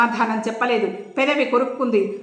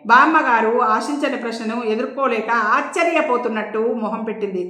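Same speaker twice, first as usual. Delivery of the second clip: usual speaking voice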